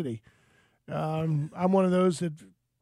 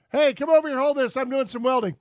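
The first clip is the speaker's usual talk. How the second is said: acted calling over; 6 semitones higher, 4 dB louder than usual. Acts out boss calling him over.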